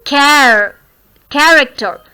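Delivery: "Care" alone, then "Character" stressed on that syllable; first-syllable stress. Shown as a demonstration. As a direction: lean on the start of "Character". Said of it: "care is to be, uh, stressed here". ("Character" में "Care" पर ज़ोर)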